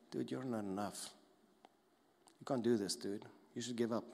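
soft, discouraging undertone; voice in your head. Acted. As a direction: quietly talk them into giving up. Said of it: "you hear a voice in your head saying".

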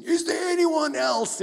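high, loud, slow arena shout; hammed-up gladiator cry. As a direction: bellow it to the crowd. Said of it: "that gladiator scene".

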